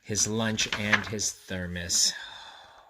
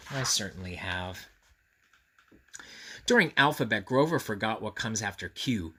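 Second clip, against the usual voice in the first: usual speaking voice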